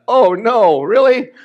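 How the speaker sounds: high, hammed-up groan of disbelief; mock horror, voice jumping up; dismayed disbelief. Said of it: "my vocational calling into ministry was".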